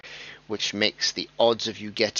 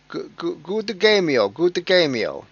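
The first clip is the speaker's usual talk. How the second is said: hammed-up, badly faked Spanish accent, higher; a clumsy fake Spanish accent, raised pitch; 7 semitones higher than usual. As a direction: attempt a mock Spanish accent and fail. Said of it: "I can't even do a Spanish accent".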